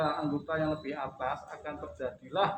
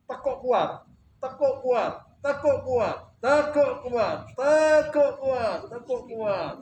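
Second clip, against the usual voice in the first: loud, higher, punchy repeated "tekuk kuat"; coaching command; 9 semitones higher, 10 dB louder, much slower than usual. Shown as a demonstration. Physio teacher coaching a patient to bend hard, loud commands. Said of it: "coaching muscle contraction".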